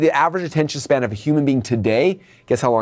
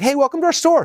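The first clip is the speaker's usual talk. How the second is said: bright, much higher greeting voice; cheery salesperson; 13 semitones higher than usual. Retold or imitated. Salesperson welcoming a customer at the door, high and cheery. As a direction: greet the customer like an eager clerk. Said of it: "That salesperson's like".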